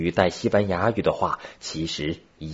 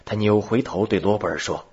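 usual speaking voice

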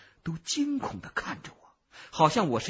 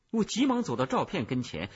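ordinary delivery, nothing marked

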